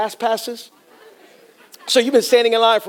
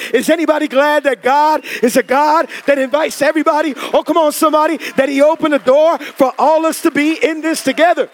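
rising preaching; 4 semitones higher than usual. Real, not acted. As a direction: lift the congregation to the close. Preaching climax.

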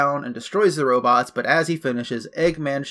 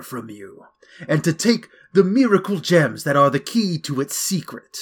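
theatrical cartoon villain, gloating; Eggman voice; 3 semitones higher, 4 dB louder than usual. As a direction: play it like a smug villain making his grand entrance. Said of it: "so Eggman's like".

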